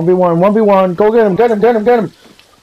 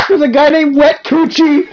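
excited high voice; 13 semitones higher, 3 dB louder than usual. Real, not acted. Amused at a player name.

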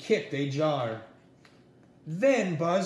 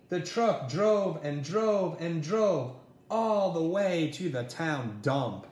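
plain reading voice

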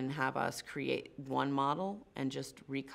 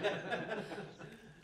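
laugh; 6 semitones higher, 4 dB quieter than usual. Real, not acted. Laughing after failing twister.

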